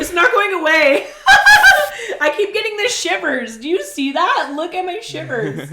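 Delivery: high loud laughing talk. Real, not acted. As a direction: laugh through the burn. Shivering from hot sauce.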